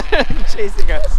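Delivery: loud, high, slow laughing cry; being chased. Real, not acted. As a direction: shout it while running from animals.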